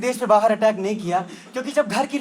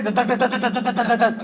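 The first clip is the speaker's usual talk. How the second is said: rhythmic imitated drilling; drill noise; slower than usual. Comic imitates drilling at the party, rhythmic. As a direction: mimic a drill, beat by beat.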